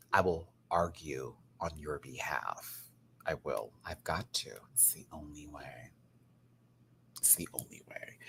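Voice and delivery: low, soft whispered vow; hushed conspiratorial whisper. Acted. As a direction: whisper it like a secret promise.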